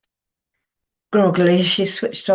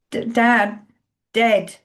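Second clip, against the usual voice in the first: choked words; 6 semitones higher, 5 dB louder, slower than usual. A narrator's reading. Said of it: "Vicky managed at last".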